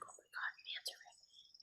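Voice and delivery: a diver's stunned whisper at her first manta; awed whisper. Retold or imitated. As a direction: whisper it as if a manta is right in front of you. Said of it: "And I'm like".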